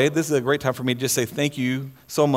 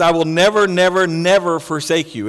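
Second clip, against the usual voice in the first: each never hit harder and louder; emphatic never; 4 dB louder than usual. Shown as a demonstration. Preacher stressing never to his congregation, emphatic. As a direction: pound the repeated never, never, never. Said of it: "he's emphatic on this".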